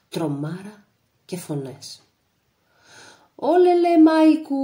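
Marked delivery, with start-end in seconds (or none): 3.3-4.6 high, loud ululating cry of the enemy; wailing war cry. A narrator's reading. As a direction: howl it out like a charging mob. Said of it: "ολόλιζαν οι Βούλγαροι". (ολολυγμός με δυνατή, ψηλή φωνή)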